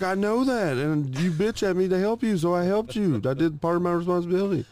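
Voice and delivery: high, loud re-enacted self-defence; heated retort. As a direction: argue back at the top of his pitch. Retold shouting back in quarrel.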